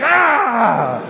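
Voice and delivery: loud drawn-out "aah"; hammed-up wounded yell; mock pained cry. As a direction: cry out as if stabbed by words. Mimes being stung by an answer.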